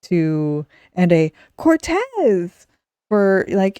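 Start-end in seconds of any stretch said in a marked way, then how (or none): 1.6-2.7 high, drawn-out call of a surname; mock dismayed call. Host imagining a buddy seeing the ship crash, mock cry. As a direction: cry it out high, falling at the end. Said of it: "there were variations for, like".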